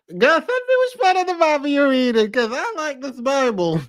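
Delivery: high, mock-sweet voice, sarcastic. Real, not acted. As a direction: gush over it with fake enthusiasm. Sarcastic praise of the clip.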